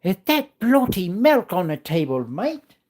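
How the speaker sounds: high, loud mock British accent. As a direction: camp it up as a cheeky Brit reading the comment. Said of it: "read my comment in your British accent".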